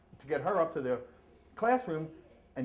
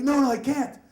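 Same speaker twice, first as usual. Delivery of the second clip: panicked plea; 8 semitones higher, 9 dB louder than usual. Retold or imitated. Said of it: "He's like".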